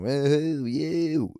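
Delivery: high, loud drawn-out crowd whoop; mock a rowdy crowd shouting abuse; jeering heckler yell; imitated heckling. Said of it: "shouting at you".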